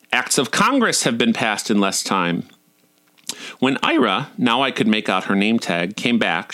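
usual speaking voice

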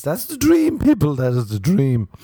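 excited proclamation. Real, not acted. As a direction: announce it like a grand revelation. Proclaiming his ideal to listeners.

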